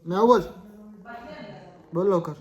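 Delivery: high, loud command to a pet; insistent shout. Real, not acted. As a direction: shout it impatiently. Ordering pet to meow. (चढ्या आवाजात हुकूम)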